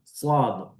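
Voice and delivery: "Swad" with a heavy Arabic s; Arabic swad sound. Shown as a demonstration. Teacher demonstrates the Arabic "Swad" to students. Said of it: "bunyinya seperti huruf swad".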